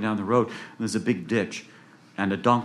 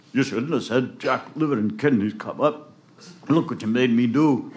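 sick man scolding; 2 semitones higher than usual. Acted.

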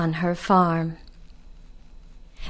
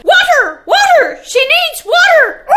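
much higher, louder rooster shouting for water; panicked rooster; 7 dB louder than usual. Acted. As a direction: squawk for help in a panic.

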